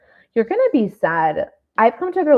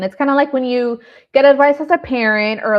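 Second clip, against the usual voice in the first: usual speaking voice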